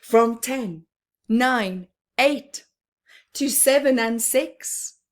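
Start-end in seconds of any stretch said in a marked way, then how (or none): none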